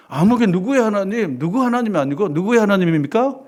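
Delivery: ordinary talk, nothing marked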